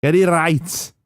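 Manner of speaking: slow, high Liverpool voice; Scouse accent. Shown as a demonstration. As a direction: stretch it out like a Scouser. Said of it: "in the Scouse accent".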